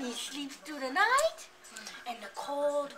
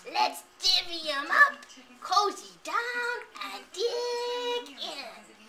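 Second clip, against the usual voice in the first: badger voice; 6 semitones higher, 5 dB louder than usual. Badger speaks in story.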